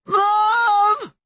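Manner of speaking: high, wailing shout; anguished cry. A narrator's reading. Son gripping tighter, crying out to his mum. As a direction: cry out for your mother. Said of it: "he cried".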